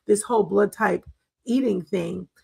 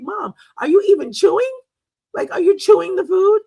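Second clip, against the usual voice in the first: higher and louder, worried pleading; alarmed urging; 10 semitones higher, 6 dB louder than usual. Retold or imitated. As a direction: call out to stop her choking.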